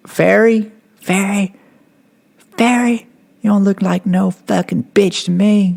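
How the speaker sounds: high, taunting instructor voice; mock him like a sneering drill sergeant; imitated instructor ridicule. Drill instructor mocking his name.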